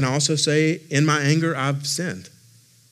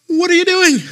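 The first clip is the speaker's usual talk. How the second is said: mock exasperated yell; 8 dB louder than usual. Dad catches son pulling flowers.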